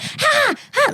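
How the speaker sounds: high hooting bird call; monkey-like parrot cry. Retold or imitated. Macaws screeching at dawn, high monkey-like hoots. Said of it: "They sound like monkeys".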